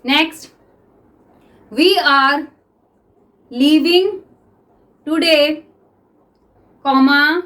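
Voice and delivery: teacher dictating to the class; dictation reading. Shown as a demonstration. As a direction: dictate word by word for students to write. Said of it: "okay, तो start".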